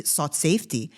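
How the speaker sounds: ordinary talk, nothing marked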